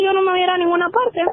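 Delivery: imitating a defiant mother, pitched high; retold indignant refusal.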